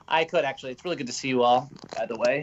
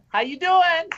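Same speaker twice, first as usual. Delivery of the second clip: playful greeting; 14 semitones higher, 5 dB louder, slower than usual. Pretend. Flirty greeting to listeners.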